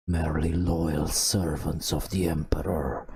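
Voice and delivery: plain reading voice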